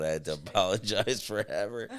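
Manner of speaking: usual speaking voice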